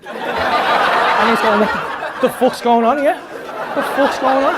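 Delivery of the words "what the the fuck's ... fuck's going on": loud, higher-pitched mock outrage; bewildered outburst. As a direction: act out a baffled friend, loudly. Acting out his bewildered friend.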